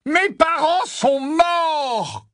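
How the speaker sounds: slow, high, full-force angry bellow. A narrator's reading. Harry answers Ron's jab about parents, bellowing. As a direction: bellow it out, dragging the words. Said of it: "beugla Harry".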